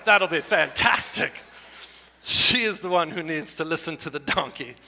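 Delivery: ordinary delivery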